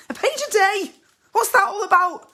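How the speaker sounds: very high, loud disbelieving echo; incredulous outrage. Acted. Wife scoffing at husband's page a day, incredulous. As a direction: repeat it as if it's absurd, pitched right up. Echoes his output in disbelief.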